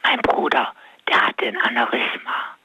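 whispering with a lost voice; strained whisper, no voice left; voiceless whisper. Real, not acted. Caller whispering to the radio host because her voice is gone.

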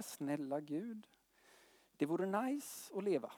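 soft, slow and timid plea; meek quiet prayer. Retold or imitated. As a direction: pray meekly, barely caring. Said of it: "Han tänkte inte lite stilla så här". (mild, quiet request to God)

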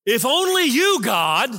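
loud, high, desperate plea; voicing David's loud lament; pleading cry to God. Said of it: "he gets to tell God what he really thinks".

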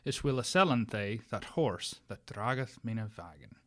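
put-on Old English sentence in period pronunciation; Old English speech. Shown as a demonstration. Old English farmer selling a horse to a Norse settler, period pronunciation. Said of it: "Old English speaker would say something like".

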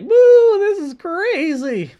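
high whooping "Woo", then a slow drawn-out "this is crazy"; mock wild cheer. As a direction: whoop it up like a dumbfounded fan. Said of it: "we're all just like".

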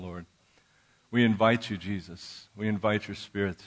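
hushed invocation, fading to near silence; prayer. Acted. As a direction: pray quietly to the Father, drawing down. Opening prayer.

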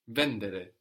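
"vendere" with the accent on VEN; stress third from end. Shown as a demonstration. Teacher illustrates accent third from the end, stress on VEN in "vendere". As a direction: punch the first syllable of "vendere", the rest light. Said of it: "have the accent third from the end".

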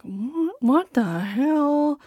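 baffled mock outburst, voice jumping up; lightly imitated shocked gallery-goer; shocked visitor. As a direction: play a startled museum visitor. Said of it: "ranging from, like".

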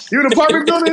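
laughing while teasing; high, fast laughing question. Real, not acted.

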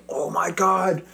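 high, thrilled gushing; excited. Retold or imitated. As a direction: squeal with delight at the news. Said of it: "We're like".

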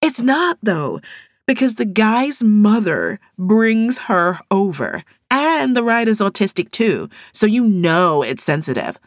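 confident friend winning the point; Latoya's triumphant rebuttal. Acted. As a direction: shut the objection down.

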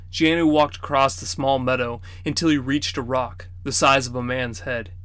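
usual speaking voice

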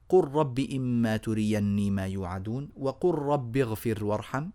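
recited with قل رب as "qur-rabbi"; no l in قل, straight into a doubled r of رب; اللام merged into الراء. Shown as a demonstration. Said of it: "دخلنا من القاف". (إدغام لام "قل" في راء "رب")

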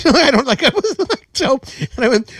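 high, laughing through words. Real, not acted. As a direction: giggle while telling it. Laughs while retelling.

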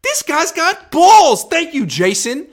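very high, slow, thrilled thanks; delighted cheering. Retold or imitated. Host cheering the reporter's bold question, high and slow. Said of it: "I was like".

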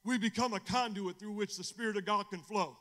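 loud, punched pulpit declaration; full-force preaching. Real, not acted. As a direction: proclaim it to the whole church.

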